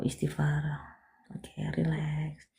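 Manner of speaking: very slow, soft breathy whisper; calm her breathing with a hush; whispered guidance through a breathing exercise; soothing whisper. Real, not acted. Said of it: "Tarik nafas dulu".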